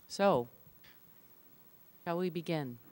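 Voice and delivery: ordinary talk, nothing marked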